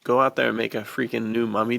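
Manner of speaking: ordinary delivery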